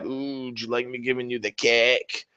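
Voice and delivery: soft, leering taunt; mock leering taunt. Opponent taunting about cacophonic: soft leering voice. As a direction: tease it like a creep.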